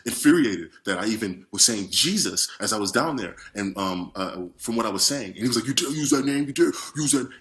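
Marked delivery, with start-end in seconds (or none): 5.7-7.3 mild mock fury; half-hearted menacing snarl; retold devil yelling at him; furious devil. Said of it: "he was basically furious".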